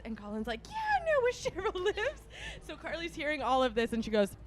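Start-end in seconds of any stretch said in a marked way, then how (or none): 0.7-2.2 much higher mocking voice; mock indignant reply. Friend insisting he knows the way, mock indignant. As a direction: imitate a friend's defensive squeal. Acting out Colin's reply in story.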